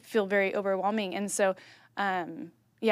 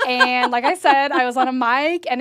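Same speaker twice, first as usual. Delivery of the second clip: laughing; 4 semitones higher, 10 dB louder than usual. Real, not acted. Laughing about pirate voice.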